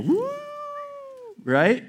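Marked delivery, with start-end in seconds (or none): 0.0-0.3 high, rising, drawn-out elk call; imitated elk bugle. Hunter imitates the bugle he heard in the distance, bugling. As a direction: hoot a far-off bull elk bugle. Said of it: "I hear a bugle".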